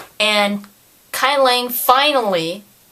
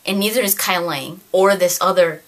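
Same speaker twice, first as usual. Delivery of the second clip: usual speaking voice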